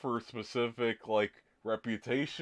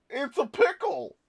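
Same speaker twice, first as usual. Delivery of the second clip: high amused disbelief; incredulous; 5 dB louder than usual. Real, not acted. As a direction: pitch it up, baffled and amused. Incredulous laughing repetition.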